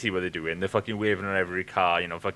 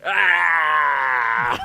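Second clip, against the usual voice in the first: loud, high, shouted ah out the window; hammed-up mock shout; mock yell; 7 semitones higher, 11 dB louder than usual. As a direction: bellow it like a silly greeting. Yelling at kids out window.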